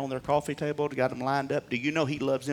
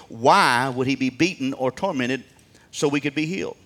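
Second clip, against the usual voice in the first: usual speaking voice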